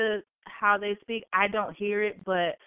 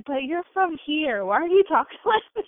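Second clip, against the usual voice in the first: loud, high mock-indignant questioning; mom's protest; 8 semitones higher, 5 dB louder than usual. Mom questioning daughter's speech, mock indignant. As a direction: challenge her, pitched up and laughing. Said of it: "she'll be like".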